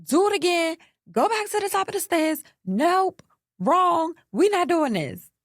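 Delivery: high, loud bossy mama; emphatic high-pitched retold yelling; imitated scream. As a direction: holler orders like a mama halting the show. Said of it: "She screamed out".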